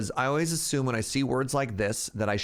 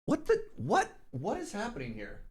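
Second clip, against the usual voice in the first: high squeaky what the, then trailing soft; sudden high-pitched confusion; startled by the British pronunciation; baffled; 9 semitones higher, 5 dB quieter than usual. Real, not acted.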